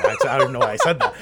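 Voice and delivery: high, laughing embarrassed; cracking up mid-sentence; laughing at himself. Real, not acted. Host laughing at his own slip.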